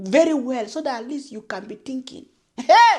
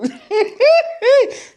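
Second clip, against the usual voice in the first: a high laugh that falls away; 9 semitones higher, 4 dB louder than usual. Real, not acted. Laughing at the letter to YouTube. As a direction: laugh it off in disbelief.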